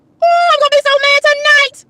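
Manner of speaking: worked-up high cry of frustration; exasperated outburst. Real, not acted. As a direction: wail it high, working yourself up. Venting, escalating repeats of one line.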